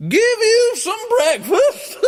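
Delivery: very high, loud, laughing shriek; cackling giantess. Acted. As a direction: cackle like a huge old woman. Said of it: "cried the old woman".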